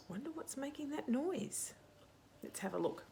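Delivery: ordinary delivery, nothing marked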